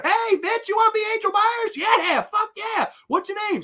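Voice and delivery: high, hammed-up casting-director voice. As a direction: play a sleazy casting guy picking up an actress. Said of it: "[name] voiced casting scene".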